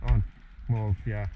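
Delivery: ordinary talk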